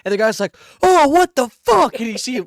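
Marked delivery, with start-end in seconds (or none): 0.8-2.0 high, loud, slow startled cry; shocked yelp. Retold or imitated. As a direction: blurt it out high and stretched like you got ambushed. Guy startled in toilet stall.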